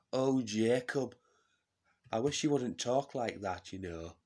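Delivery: loud, lifted put-on voice; Jess Phillips impression. MP chiding Jacob for how he talks, loud put-on voice. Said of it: "Jess Phillips has lost the accent".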